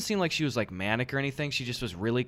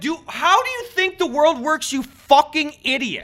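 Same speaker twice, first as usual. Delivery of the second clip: much higher and louder, sneering outrage; mock furious rant; 8 dB louder than usual. Ranting at a customer returning a fan, mock furious. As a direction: blow up at the clueless customer.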